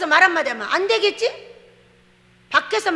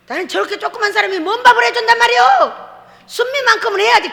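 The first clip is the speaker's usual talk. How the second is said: higher, louder and fast protest; indignant exclaim; 8 semitones higher, 6 dB louder than usual. Retold or imitated. Pastor retelling her doubt about the tiny cook, higher, loud and fast. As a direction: blurt out the disbelief.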